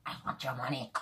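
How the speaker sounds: quiet, raspy greedy cartoon crab captain; Mr. Krabs voice. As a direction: growl it low like a miserly cartoon crab. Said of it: "Mister Krabs now".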